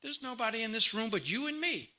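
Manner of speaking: lightly voiced impatient scolding; wife snapping at her husband, mildly done; irritated wife. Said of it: "she would say".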